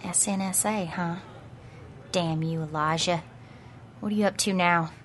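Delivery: soft, low private grumble; muttering to herself. Acted. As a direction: grumble under her breath. Muttering alone after agents leave.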